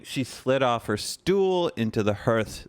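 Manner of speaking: usual speaking voice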